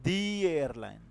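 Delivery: "دی" with a long ee before the vowel; "the" as "dee". Shown as a demonstration. Teacher giving a vowel-sound example, "دی" said "dee". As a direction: stretch "دی" into "dee", then airline. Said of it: "وال sound یہ ہمارے".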